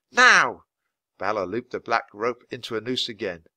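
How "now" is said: loud high one-word command; sharp yell. A narrator's reading. Grinda yelling at Balor to act now, loud. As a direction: snap it out urgently. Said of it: "she yelled".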